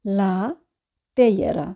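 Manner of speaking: usual speaking voice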